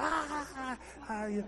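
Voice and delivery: a light high mock laugh; imitated laughing. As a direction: laugh it off like a carefree kid. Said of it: "I'd be back there laughing".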